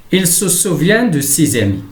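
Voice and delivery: careful dictation pace, pitch lifted; example read-out. Shown as a demonstration. Teacher reads example sentence aloud.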